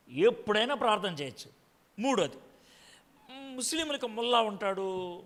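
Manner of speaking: normal talking voice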